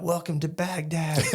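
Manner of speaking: high-pitched hammed-up greeting; mock welcome. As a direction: greet arrivals like a zany field reporter. Mock interview greeting to arriving Americans.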